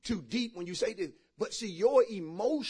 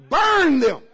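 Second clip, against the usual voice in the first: shouted, much higher burn command; loud command; 7 semitones higher, 13 dB louder than usual. Acted. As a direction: bark it at the congregation. Preacher commands loudly.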